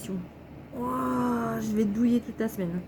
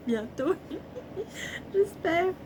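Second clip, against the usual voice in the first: laughing; 10 semitones higher, slower than usual. Real, not acted. Wistful hope, breaks up.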